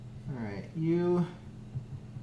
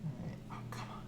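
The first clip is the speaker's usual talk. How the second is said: whispering; 3 semitones lower, 19 dB quieter, much faster than usual. Real, not acted. Coaxing dough, whispered.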